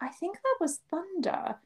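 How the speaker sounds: high, soft casual guess; softly imitated boyfriend; mock calm boyfriend. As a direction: imitate him shrugging it off. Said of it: "Shu was like".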